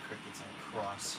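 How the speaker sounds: a slow grumble to yourself; low muttered curse. Real, not acted. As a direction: mutter it low and slow under your breath. Swearing under breath.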